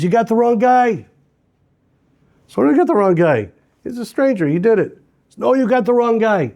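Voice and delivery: insisting to the police they have the wrong man; heated protest. Acted. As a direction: push back hard at the police. Arguing with the police.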